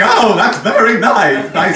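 high, loud cartoon king voice; drawn-out silly character impression; King Candy. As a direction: exclaim it high and slow like a dotty cartoon ruler. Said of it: "sound like King Candy".

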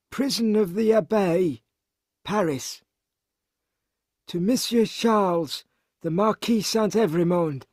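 high-pitched voice reading an address; formal plea from a prisoner, pitched high; letter-writer's voice. Acted. Narrator reads Gabelle's letter in character.

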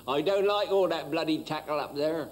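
lightly voiced Sussex farmer grumbling; a hint of a Sussex yokel grumbling; rustic Sussex accent. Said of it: "this fellow says".

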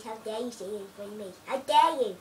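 mock outrage. Mock offence at friend.

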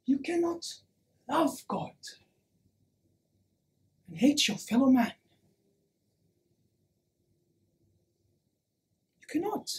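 very soft, with long pauses; quiet, weighty admonition; hushed warning. Acted. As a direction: bring it right down to a grave hush. Grave warning to the crowd.

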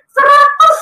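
very high amazed cry; astonished exclamation. Retold or imitated. Nurse announcing the reading to her patient, astonished exclamation. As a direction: ring it out like a stunned nurse. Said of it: "Dia kaget-kaget luar biasa".

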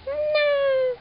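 very high squeaky answer; squeaky guinea pig voice. Pretend. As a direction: squeak it like a tiny pet.

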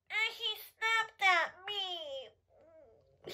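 high, very slow stretched-out words; playful mock distress. Telling children the turtle snapped at her, mock distress. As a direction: draw it out high in mock woe.

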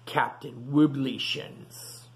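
usual speaking voice

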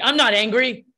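acted upset protest, pushed loud; indignant retort. Acts upset person snapping back at validation.

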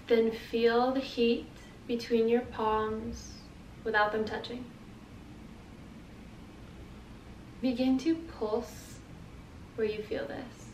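soft, unhurried guiding voice; guided meditation. Acted. Teacher leading listeners through feeling their energy, guided meditation. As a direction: guide them gently through the exercise, leaving space.

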